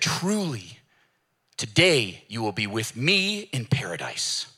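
slow, whispered promise; gentle whisper. Retold or imitated. Jesus on the cross promising the criminal, slow whisper. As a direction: whisper it tenderly and slowly.

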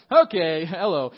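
loud mocking disbelief; sarcastic "okay hello". Real, not acted. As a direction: scoff at how obvious it is. Mocking a pointless website.

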